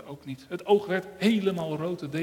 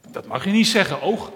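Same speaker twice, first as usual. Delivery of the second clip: louder, higher protest; standing up for someone; 5 semitones higher, 8 dB louder than usual. Acted. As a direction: defend the little finger firmly. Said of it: "zei het oor, die opkwam voor de pink". (luid en hoger protest tegen het oog)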